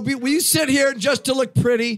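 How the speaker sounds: loud, high call across the room. Real, not acted. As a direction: call her over loudly. Host calling out to Rhonda.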